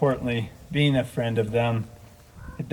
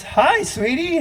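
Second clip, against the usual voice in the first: high, sing-song hello to her husband; sweet wife greeting; slower than usual. Retold or imitated. Retelling how Denise greeted Todd, high and sweet. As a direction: chirp it up high like a wife caught mid-chore. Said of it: "she goes".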